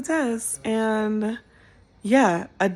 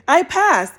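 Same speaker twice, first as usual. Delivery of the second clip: elated; 9 semitones higher, 7 dB louder than usual. Real, not acted. Exclaims result.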